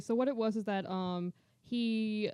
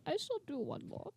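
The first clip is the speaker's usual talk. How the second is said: higher, softer put-on composer voice; 5 semitones higher, 8 dB quieter than usual. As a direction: give in softly in a mock composer voice. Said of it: "And he's like".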